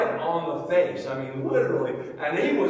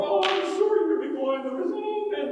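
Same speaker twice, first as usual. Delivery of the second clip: mock apology; 4 dB louder than usual. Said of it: "We would definitely be all".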